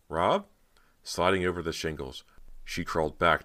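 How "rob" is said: high, questioning call; anxious call. A narrator's reading. Cody gets no reply from Rob, anxious questioning call. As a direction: call again, fearing no answer. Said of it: "she called, her chest aching".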